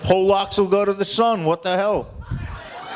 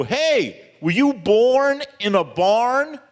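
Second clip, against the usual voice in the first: higher, drawn-out mock telling-off; scolding mother; 6 semitones higher, much slower than usual. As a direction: act out a nagging mom, slowly.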